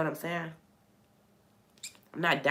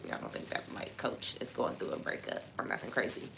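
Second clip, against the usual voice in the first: whispered aside; 3 semitones lower, 9 dB quieter than usual. Real, not acted. Aside to viewers.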